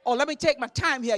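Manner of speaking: ordinary talk, nothing marked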